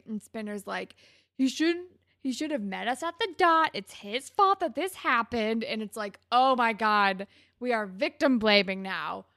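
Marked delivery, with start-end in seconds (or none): 1.4-5.6 raised, louder imitation of indignation; defensive blaming. Host imitating Spinner blaming his friend, indignant and loud. 6.3-9.2 loud, exasperated disbelief; appalled outburst. Real, not acted. Host reacting to Spinner's victim blaming, loudly appalled.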